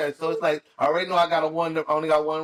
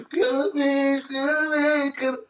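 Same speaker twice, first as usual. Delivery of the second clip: high, silly cartoon voice; squeaky polite Goofy impression; 9 semitones higher, slower than usual. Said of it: "like Goofy tip".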